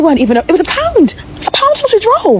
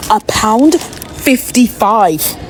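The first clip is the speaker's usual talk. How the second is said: slow disbelief; 6 semitones lower, much slower than usual. Real, not acted. Repeating the price in disbelief.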